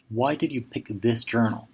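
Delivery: usual speaking voice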